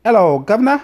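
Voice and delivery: high, loud hammed-up English accent; badly faked cockney greeting; mock English accent. Showing off his accents to his wife, mock English accent. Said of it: "that's England".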